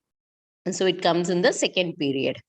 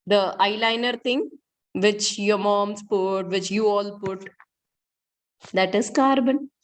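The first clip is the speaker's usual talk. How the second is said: normal talking voice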